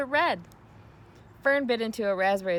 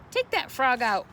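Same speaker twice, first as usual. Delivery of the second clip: a firm, louder order; 4 semitones higher, 5 dB louder than usual. A narrator's reading. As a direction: boss her brother around. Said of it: "ordered Fern".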